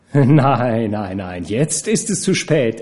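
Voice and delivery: plain reading voice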